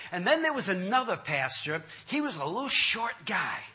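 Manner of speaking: ordinary talk, nothing marked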